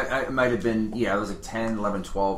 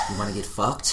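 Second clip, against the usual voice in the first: mock whispered come-on; 2 semitones lower, 6 dB quieter than usual. Mock proposal line.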